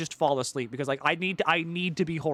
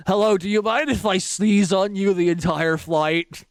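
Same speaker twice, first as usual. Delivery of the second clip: slow, loud, higher put-on pest voice; annoying seatmate; 4 semitones higher, 9 dB louder than usual. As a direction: ham up a rude passenger asking to sneeze. Airplane seatmate acted out.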